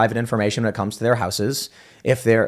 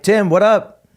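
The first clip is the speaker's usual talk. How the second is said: higher, louder greeting shouted over the table; calling across the room; 8 semitones higher, 5 dB louder, slower than usual. Retold or imitated. A guy across the casino pit calls to Tim, loud friendly call. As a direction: hail a buddy from across the pit.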